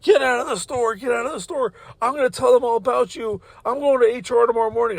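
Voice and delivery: high-pitched mock yell; retold yelling. Boss throwing worker out of store, imitated yelling. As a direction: imitate a frantic tirade, pitch held high at moderate volume. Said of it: "she's yelling at me".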